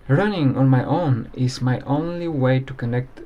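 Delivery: soft, low reflective voiceover; voiceover narration. Acted. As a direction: narrate quietly, as if to yourself.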